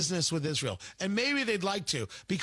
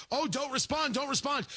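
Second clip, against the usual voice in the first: higher-pitched hand-wringing imitation; mock worry; 6 semitones higher than usual. Critics urging no response to Iran attacks, mock worry. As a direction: plead in a mock fretful voice.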